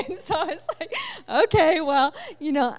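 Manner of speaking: high, loud laughter breaking into the words; laughing. Real, not acted. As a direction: laugh at your own blunder. Laughing at her own mistake.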